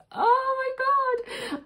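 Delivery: very high, slow, dog-whistle squeal of excitement; ultra high squeal. Real, not acted. Seeing the hourglass box, a drawn-out dog-whistle squeal. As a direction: stretch it out so high only dogs could hear. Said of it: "only my dogs could hear me then".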